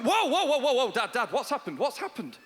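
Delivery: mock panic at a lost icon; panicked. Son panics at dad deleting icon, high panicky whoas. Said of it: "I panicked".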